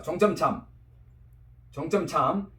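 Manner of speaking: 종점 참 in plain Korean, with m endings; Korean pronunciation. Shown as a demonstration. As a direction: read 종점 참 the Korean way, untensed first sound. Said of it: "내가 한국 발음 한번 들어봐".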